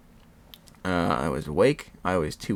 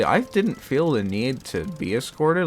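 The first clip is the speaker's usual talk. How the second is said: normal talking voice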